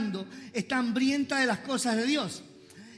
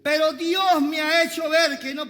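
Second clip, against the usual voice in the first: loud, raised pulpit declamation; full-force preaching; 5 semitones higher, 6 dB louder than usual. Acted. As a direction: proclaim the apostle's speech to the crowd.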